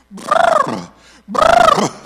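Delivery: loud, very high excited brrr; imitated uncontrolled trill. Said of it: "kinda lost control of herself".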